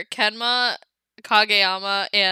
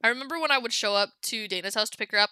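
ordinary talk, nothing marked

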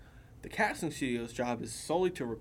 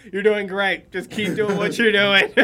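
loud, upbeat reassurance; hearty encouragement; 7 semitones higher, 13 dB louder than usual. Retold or imitated. Crew hyping up the nervous newcomer, loud and cheery. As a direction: pump them up loudly like a cheering coach. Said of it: "They're like".

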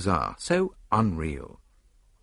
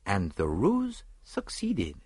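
ordinary delivery

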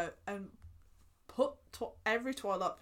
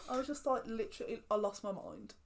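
normal talking voice

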